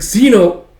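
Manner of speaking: high, loud call of the name; girl crying out as she wakes from a dream. Acted. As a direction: scream his name as he vanishes. Yona cries out, waking.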